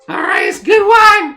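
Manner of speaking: high, loud squeaky sales cry; squeaky halfling. Acted. Halfling sells a traveller wine, squeaky shout. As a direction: squeal it loudly and eagerly. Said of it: "this kinda squeaky voice like".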